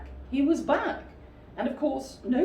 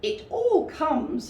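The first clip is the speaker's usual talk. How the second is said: high, loud, slow and stern; 10 semitones higher, 6 dB louder, slower than usual. Acted. Rabbit scolds stuck Pooh, slow stern and loud. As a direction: lay down the law, slowly. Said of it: "said Rabbit sternly".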